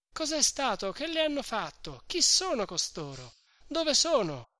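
high, excited shouted questions; many voices shouting at once; crowd shouting. A narrator's reading. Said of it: "gli veniva gridato da cinquanta voci".

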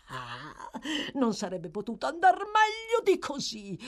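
put-on swooping old schemer, high peaks, hushed lows. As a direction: savour the scheme with mounting glee.

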